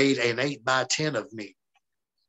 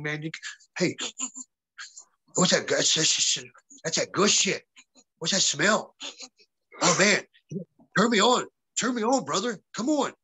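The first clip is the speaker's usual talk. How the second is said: a wrestler voiced higher, begging for a smoke; stoner buddy voice; 5 semitones higher than usual. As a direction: play the excited stoner asking for weed.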